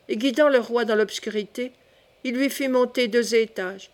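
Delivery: ordinary delivery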